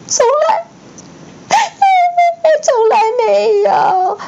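high, slow sobbing cry; wailing elf. A narrator's reading. Dobby wailing to Harry, high and slow. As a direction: draw it out in a wail. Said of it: "他哭叫道".

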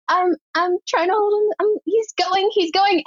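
high-pitched mock alarm; panicked. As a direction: imitate a helpless warning over the walkie. Retold panicked walkie report, losing hold.

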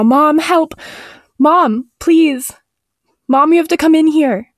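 high, desperate calls for help; mock screaming. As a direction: plead high for mother, without full volume. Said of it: "I was screaming now".

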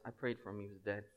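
dead serious. Retold or imitated. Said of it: "looks at me dead serious and goes".

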